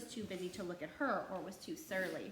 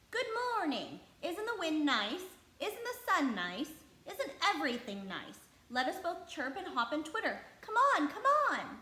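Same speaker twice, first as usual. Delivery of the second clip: much higher, louder bird voice, bubbling; chirpy robin; 8 semitones higher, 7 dB louder than usual. A narrator's reading. The robin inviting Mary to play, chirpy and high. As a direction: hop and chirp the words. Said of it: "as if he said".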